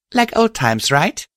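joking. Acted. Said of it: "I said jokingly".

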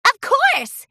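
high, loud happy shout; shouted yes. Acted. Pinkie answering the fillies, high happy shout. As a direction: shout it as you spring up. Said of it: "Pinkie shouted".

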